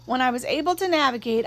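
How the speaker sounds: ordinary delivery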